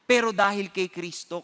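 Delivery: usual speaking voice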